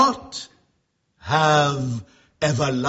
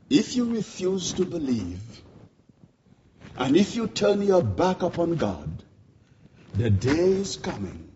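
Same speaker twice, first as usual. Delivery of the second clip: ordinary talk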